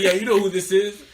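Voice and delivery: loud, high mock confrontation, amused; imitated caller's challenge. Boyfriend calling his rival, loud laughing imitation. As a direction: mimic the caller through laughter.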